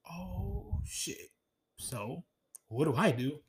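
very soft retold dismay; hushed uh-oh. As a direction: say it under your breath, caught out. Retold dread as couple argues.

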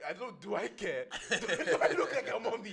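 high, loud, laughing mock; laughing mockery. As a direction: scoff through laughter. Senior mocks crying junior.

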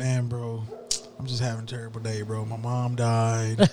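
the manager faking sorrow to guilt him; mock pleading. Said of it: "the manager, he's like".

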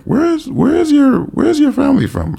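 very high-pitched, halting question; mock-puzzled, searching for the words; retold puzzled curiosity. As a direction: imitate someone baffled and probing. Retells people noticing, asking origins.

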